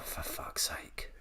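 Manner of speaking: slow, breathless whisper of exasperation; weary whisper at the absurd call. Real, not acted. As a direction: let it out under the breath. Muttered exasperation after the story.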